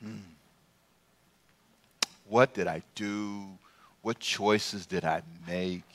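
low, slow, soft pondering; musing to himself in a low voice; brooding inner thought. Acted. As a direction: think aloud with heavy pauses, lingering on each question. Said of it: "he's thinking".

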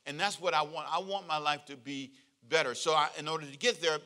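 ordinary talk, nothing marked

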